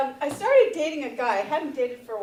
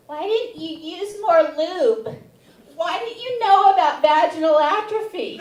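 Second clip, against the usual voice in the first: scolding; 4 semitones higher, 7 dB louder than usual. Retold or imitated. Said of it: "she is scolding me".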